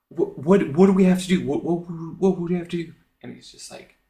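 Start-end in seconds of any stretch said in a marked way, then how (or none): 0.1-2.9 flustered acted stammer. Flustered Caesar asks what to do.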